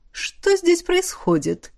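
slow, hoarse, velvety and unhurried; lazily calm voice full of power; hoarse, lazy calm. A narrator's reading. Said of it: "Хриплый бархатный голос гостя прозвучал подчёркнуто лениво".

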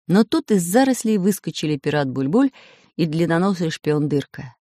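usual speaking voice

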